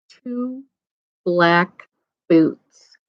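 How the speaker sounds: usual speaking voice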